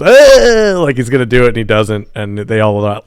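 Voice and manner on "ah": high, loud fake lunge scream; mock attack yell. Harry bursts into the pub with a loud mock attack yell. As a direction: yell as if about to strike.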